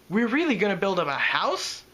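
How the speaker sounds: mild plaintive pleading, wanting the answer to be no; plaintive question. Acted. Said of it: "she asked plaintively".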